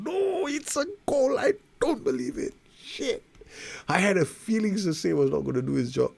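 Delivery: high, loud exasperated cry; frustrated outburst. Real, not acted. Manager watching his defender concede, exasperated. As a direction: vent disbelief at the defender.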